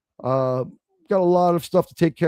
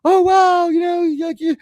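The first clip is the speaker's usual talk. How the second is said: much higher mock mom voice; imitating mom; 12 semitones higher than usual. Son imitating his mom reading DNA results, much higher. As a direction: gush like an excited mother. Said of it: "my mom was like".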